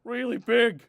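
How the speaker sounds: loud building plea. Acted. Letter builds to the signature.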